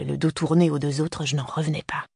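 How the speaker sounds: ordinary delivery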